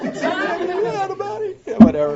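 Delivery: high, slow, laughing disbelief. Real, not acted. Mocking the out-of-body listeners, laughing high. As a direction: scoff at the idea through laughter. Laughing mockery of his cooler.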